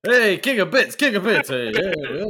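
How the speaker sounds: high, loud teasing call; mock hail to the absent co-host. Real, not acted. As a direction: shout it across the room at the king of bits.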